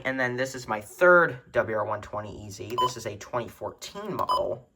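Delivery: ordinary delivery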